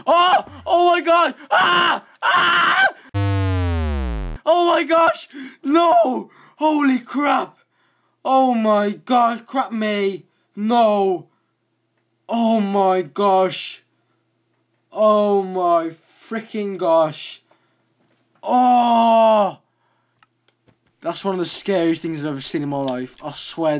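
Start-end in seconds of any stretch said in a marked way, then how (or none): 0.1-7.6 much higher, loud panicked shrieks; terrified screaming. Real, not acted. Gamer jumpscared by an Enderman, screaming in terror. 8.2-17.4 higher, louder, slow drawn-out cries; shaken exclamations. Real, not acted. Gamer recovering from the Enderman scare, drawn-out shaken cries. 18.4-19.6 loud, higher cry of alarm; frightened yelp. Real, not acted. Gamer startled again by the Enderman, loud yelp.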